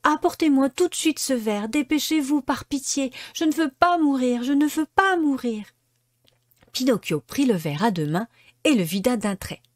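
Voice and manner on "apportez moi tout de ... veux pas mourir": high, urgent begging; pleading. A narrator's reading. Pinocchio begging the fairy for the medicine, frantic high plea. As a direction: beg for the glass before it is too late. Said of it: "supplia alors la marionnette".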